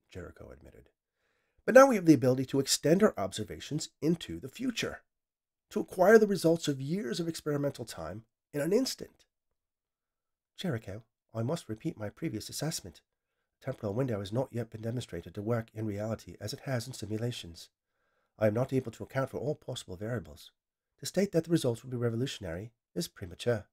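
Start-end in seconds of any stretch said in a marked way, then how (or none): 1.6-9.2 higher, keen inventor carried away; enthusiastic scientist. Acted. Scientist explains his vision to the AI, higher and eager. 10.5-23.7 softer, level machine assistant; calm, patient correction; measured AI voice. Acted. AI cautions the scientist, softer and level. Vivian's AI voice.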